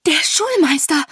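loud, high, sudden outburst; bursting out. A narrator's reading. Sophie naming the enemy leader, bursting out. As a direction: blurt it out in alarm. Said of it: "brach es aus Sophie hervor".

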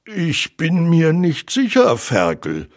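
a touch of nervous doubt. A narrator's reading. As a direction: answer with mild nerves, dropping on the name. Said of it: "antwortete Puh nervös".

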